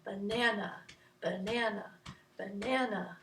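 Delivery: soft rhythmic 'banana', pushed on the middle syllable; 'banana' evenly chanted, second syllable stressed; middle-syllable emphasis. Shown as a demonstration. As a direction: repeat 'banana' quietly, leaning on 'na'. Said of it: "it has a little bit of emphasis".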